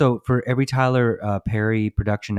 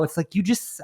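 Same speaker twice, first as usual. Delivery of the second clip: ordinary delivery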